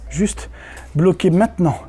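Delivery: hypnotist voice. Acted. Hypnotist puts subject under.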